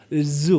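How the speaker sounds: 즈 said as a buzzing English J; J sound demo. Shown as a demonstration. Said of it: "이게 즈 발음이에요".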